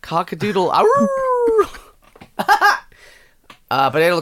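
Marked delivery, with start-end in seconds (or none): 0.7-1.7 high yelp. Real, not acted. Sudden cry in the bit.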